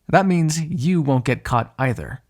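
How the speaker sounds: normal talking voice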